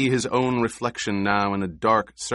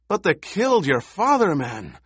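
high, loud appeal in disbelief; heated, incredulous outburst; outraged protest; 10 semitones higher, 4 dB louder than usual. A narrator's reading. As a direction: protest hotly, pitch jumping up, then dropping. Halleck protests the smuggler's inaction.

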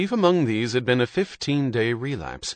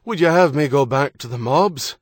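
put-on Irish accent; 4 semitones higher, 7 dB louder than usual. A narrator's reading. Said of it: "In his best Irish accent, John demanded".

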